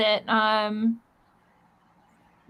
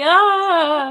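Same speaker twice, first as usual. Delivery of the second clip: overwhelmed cry; 6 semitones higher, 7 dB louder than usual. Retold or imitated. Moved by adopted pets video.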